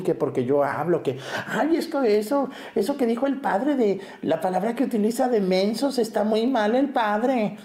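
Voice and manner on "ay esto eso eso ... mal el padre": put-on high, whiny old-lady complaint; mock the women who gossip about the priest; mocking gossipy old woman. Priest mimicking a complaining churchwoman, high and whiny. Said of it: "Usted vieja que va y se queja de mí".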